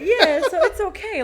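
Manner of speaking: high, loud laugh through the words. Real, not acted. To her friend, laughing at the joke. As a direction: laugh while agreeing.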